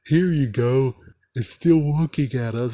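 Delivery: a light mocking take on the smug prankster; mocking jerk. Mocking the jerk who left the Slappy book out. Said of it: "somebody was a freaking jerk ass and be like".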